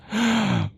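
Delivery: short high mad chuckle; gloating cackle; villain's laugh. Acted. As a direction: laugh at your own madness. Madman laughs at himself.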